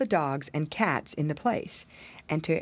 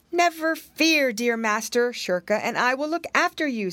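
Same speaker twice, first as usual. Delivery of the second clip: reassuring; 6 semitones higher, 4 dB louder than usual. Acted. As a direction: soothe him like a loyal pet. Cat comforts master.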